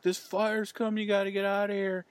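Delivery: high, alarmed urging voice; urgent warning. Retold or imitated. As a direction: warn them the fire's coming. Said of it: "He's like".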